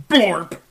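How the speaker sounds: loud wet blorp; gloopy bubbling slime voice. Acted. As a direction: splat it out like sinking into jelly.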